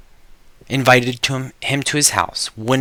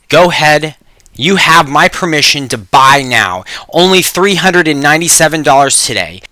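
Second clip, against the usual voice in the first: louder, pushy infomercial close; sales pitch; 5 dB louder than usual. Acted. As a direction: urge them to buy right now.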